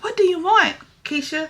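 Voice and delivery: high, irritated sister answering door; mock sister's snap. Sister annoyed at a knock on the door, high and sharp. Acting out sister's rude greeting.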